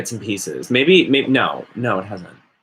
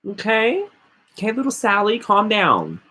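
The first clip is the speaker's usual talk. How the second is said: put-on much higher, talking down to a little girl; condescending soothing; 10 semitones higher than usual. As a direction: pat her on the head with your voice. Talking down to imagined child.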